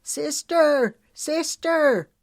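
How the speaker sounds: put-on carrying the girl home, yelling loud and high for Sister. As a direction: yell for help while running with her.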